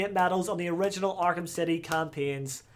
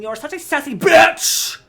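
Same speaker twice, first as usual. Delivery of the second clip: high, loud gleeful exclamation; excited outburst; 9 semitones higher, 9 dB louder than usual. Real, not acted. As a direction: build to a shout on the last word. Gleeful outburst at Catwoman's line.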